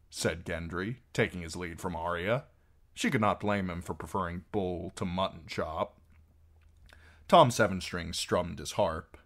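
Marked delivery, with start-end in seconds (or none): none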